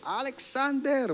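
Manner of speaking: imitated angry call, high and drawn out; very angry mother. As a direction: stretch the full name like a furious mom. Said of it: "mama ko talagang galit na galit". (gayang galit na galit na nanay, mataas at mahaba)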